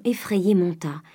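ordinary delivery

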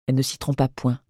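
plain reading voice

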